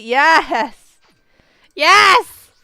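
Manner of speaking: loud high triumphant yes; yelled yes. Real, not acted. Streamer winning the fifty-fifty, loud high yes. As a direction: shout it with a fist pump.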